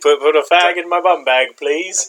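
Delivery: put-on English voice, polite ask at the end; mock British accent. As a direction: echo the Brit joke with a mock posh please. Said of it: "That was not a bad British".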